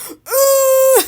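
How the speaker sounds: high, loud giggly imitated scream; mock scream. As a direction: ham up the girl's roar while laughing. Imitating Olive's endless roar.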